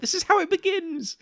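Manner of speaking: very high mock-horrified squeal; mock alarm. Co-host mocking budgie talk, high-pitched mock alarm. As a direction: pitch it way up in pretend dread.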